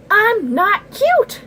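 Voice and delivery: high, loud, drawn-out shriek; shrill-pitched indignant character shout; indignant yell. Acted. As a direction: yell the denial at full volume. Character angrily denies being cute.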